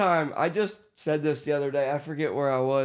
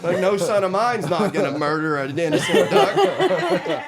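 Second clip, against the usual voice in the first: mock dad scolding through laughter; louder, laughing put-on father; half-laughing imitation of dad; 3 semitones higher, 5 dB louder than usual. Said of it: "dad voiced in story".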